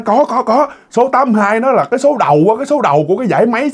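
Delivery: high, hurried mock rebuttal; crowd member insisting. Crowd argues over the winning number.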